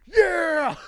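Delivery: a crazy triumphant holler; wild yell. Retold or imitated. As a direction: whoop it out very high and very loud. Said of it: "and John goes".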